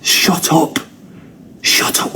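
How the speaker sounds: whispered shut up; whispering to dad in the cinema; hushed plea. Retold or imitated. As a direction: hiss it under your breath. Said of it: "We're gonna get kicked out".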